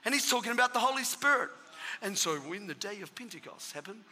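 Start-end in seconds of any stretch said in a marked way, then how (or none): 2.0-4.0 lower, much softer, as if heard from far off; a preacher's words going faint in your ears; fading away. Retold or imitated. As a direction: let the voice drain away out of earshot. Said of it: "all of a sudden I can't hear anything".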